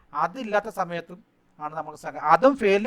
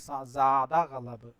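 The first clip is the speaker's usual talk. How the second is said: സാദ ഗളബ് spelled out very slowly; teacher drawing out സാദ ഗളബ് word by word; slow model answer; 4 semitones lower, 3 dB quieter, much slower than usual. Shown as a demonstration. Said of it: "teacher models the answer".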